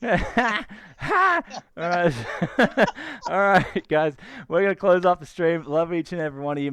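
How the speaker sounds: over-the-top Aussie sign-off; mock Aussie accent. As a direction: play a cheesy Aussie streamer signing off. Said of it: "you just sound like, like a crap guy".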